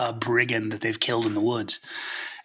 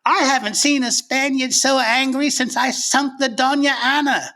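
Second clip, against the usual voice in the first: English piratess; 14 semitones higher, 5 dB louder than usual. Retold or imitated. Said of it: "and she was like".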